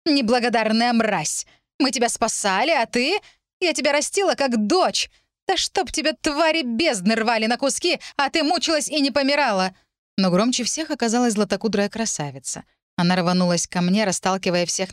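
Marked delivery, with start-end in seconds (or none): 0.1-9.8 высокий негодующий голос, проклятия; брани и проклинай, поднимая голос; злобная брань. A narrator's reading. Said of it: "куда менее деликатной в выражениях". (гневно, с надрывом, на повышенных тонах)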